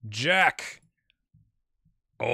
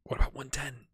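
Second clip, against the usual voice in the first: sneaky whisper. Pretend. Sneaky lower offer.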